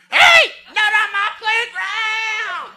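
slow, high, loud teacher's yell; yard duty teacher. Retold or imitated. Yard duty teacher running at the fight, slow loud yell. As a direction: bellow across the playground. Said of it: "yard duty teacher ... is running".

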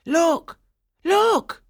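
high, loud call; excited cry. A narrator's reading. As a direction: call out to point someone to a sight. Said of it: "The shepherds cried out".

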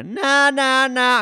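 high, loud drowning-out chant; shouting over someone. Retold or imitated. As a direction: block it out with loud nahs, fingers in ears. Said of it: "shout over it while they were doing the take".

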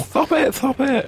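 high squeaky stop it; high mock-shocked squeal; squealed protest. Mock protest at crude story.

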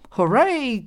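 high bright cheer. A narrator's reading. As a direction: cheer like a greedy child. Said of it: "cried Thorny".

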